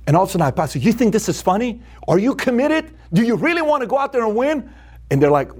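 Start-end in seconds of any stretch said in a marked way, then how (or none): 0.8-4.7 high-pitched, incredulous questioning; stern challenge. Retold or imitated. Speaker confronting laughing listeners during his strong talk, challenging. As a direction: press them question after question.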